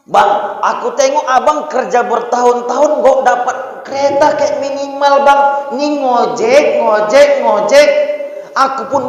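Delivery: high, loud whiny nagging; nagging wife. Retold or imitated. Preacher voicing a wife nagging her ojek-driver husband, loud and whiny. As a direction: pester the husband for a nicer life.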